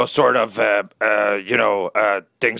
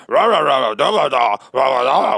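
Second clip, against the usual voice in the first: mock caveman grunting; a hammed-up caveman babble; caveman grunts; 3 dB louder than usual. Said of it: "which is caveman for".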